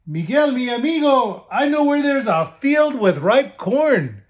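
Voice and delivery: high, sweet-talking sly wolf. Acted. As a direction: lure him with fake friendliness. Wolf plays a trick.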